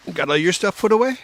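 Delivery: ordinary talk, nothing marked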